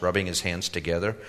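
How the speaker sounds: usual speaking voice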